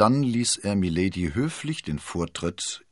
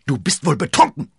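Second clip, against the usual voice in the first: laut und hoch empört; entrüstet; 9 semitones higher, 11 dB louder than usual. A narrator's reading. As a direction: dem Gegenüber entrüstet an den Kopf werfen. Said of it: "rief Milady entrüstet". (empörter Ausruf)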